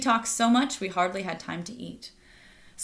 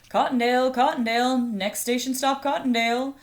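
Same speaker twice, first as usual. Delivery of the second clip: a train conductor's sing-song call, louder and higher; 5 semitones higher, 4 dB louder than usual. Acted. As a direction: announce the stop down the carriage. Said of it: "yelled the conductor".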